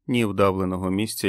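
plain reading voice

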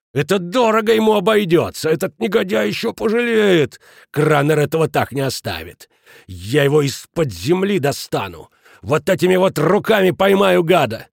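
high, loud threats; enraged. A narrator's reading. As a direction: vow revenge like a caged beast. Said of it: "в бешенстве ходил взад-вперёд". (в бешенстве, громкие угрозы)

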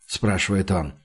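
usual speaking voice

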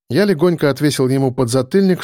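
ordinary delivery, nothing marked

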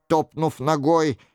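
ordinary delivery

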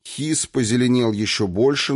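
ordinary delivery

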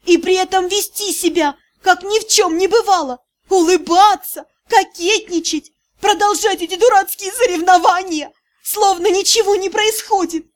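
high, tearful hysterical cries; girl in hysterics with squealing notes. A narrator's reading. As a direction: let hysteria take over. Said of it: "зашлась в истерике".

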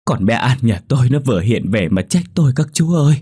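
a distraught complaint; sobbing out that the ghost came back; tearful lament. Acted. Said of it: "bà cụ Xuân lại ngồi khóc".

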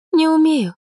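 put-on high, small girlish admission; timid confession. A narrator's reading. Girl confesses she cannot cook, timid. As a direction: pipe it up helplessly, very high.

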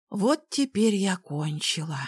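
usual speaking voice

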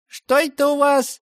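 put-on high puzzled old woman's voice. A narrator's reading. Old gatekeeper puzzled at the gate, high voiced. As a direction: squint and ask like a half-deaf granny.

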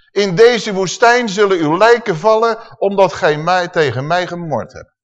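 loud, high, slow proclamation; solemn judgement. Acted. Preacher reads God's sentence on the grumblers, loud and slow. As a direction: pronounce the sentence from scripture.

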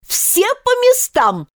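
high, drawn-out commanding cry; thunderous shout. A narrator's reading. Queen summoning everyone to play, thunderous command. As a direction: holler the order, high and stretched. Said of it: "закричала королева громовым голосом".

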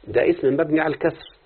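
ordinary talk

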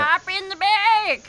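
loud, high, barked order; trucker voice. Acted. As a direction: shout it from the truck cab. Trucker orders them aboard.

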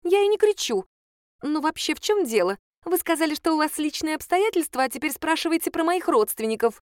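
high, indignant protest; agitated high voice. A narrator's reading. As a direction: denies shouting while shouting. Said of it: "Не кричите так".

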